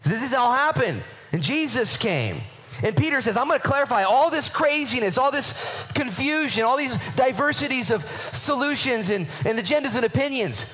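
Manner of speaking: high-pitched, fervent preaching; real excitement, driving the point home; excited. Real, not acted. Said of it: "I'm excited".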